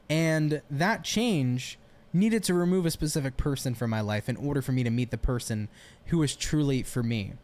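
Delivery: ordinary talk, nothing marked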